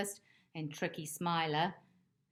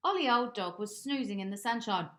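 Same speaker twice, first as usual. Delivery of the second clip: plain reading voice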